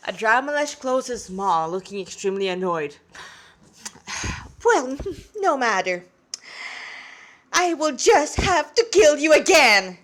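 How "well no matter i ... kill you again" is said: louder, higher demon with a scornful laugh; menacing demon voice. Acted. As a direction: laugh it off, then promise to kill him. Annoyed demon threatens the boy.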